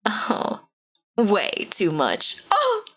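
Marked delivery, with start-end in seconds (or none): 2.5-2.8 high squealing oohs with laughter; squealing fangirl. Real, not acted. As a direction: squeal over him, delighted. Fangirling over hot character.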